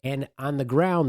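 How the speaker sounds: ordinary delivery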